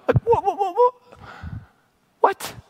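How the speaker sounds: high, stuttered string of whats; baffled disbelief. Acted. Preacher reacting to people's indifference, baffled disbelief.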